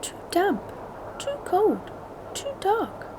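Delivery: high tiny mouse voice; small high mouse complaining; squeaky mouse. A narrator's reading. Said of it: "Mouse squeaks".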